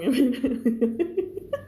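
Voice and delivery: helpless giggle after a joke; giggling. Real, not acted. Teacher amused by her own mimicry, giggling. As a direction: laugh at your own impression.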